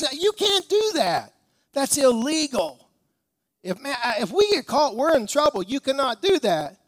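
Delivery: high, alarmed pleading; panicked protest. Retold or imitated. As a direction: beg him to stop, lightly voiced worried fisherman. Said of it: "He goes".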